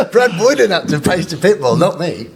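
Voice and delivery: high-pitched, laughing protest; laughing disbelief. Real, not acted. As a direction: splutter it out through laughter. Incredulous, laughing reaction to question.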